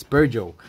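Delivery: Peugeot said firmly as "PUR-zho"; correct pronunciation. Shown as a demonstration. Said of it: "it's pronounced Peugeot".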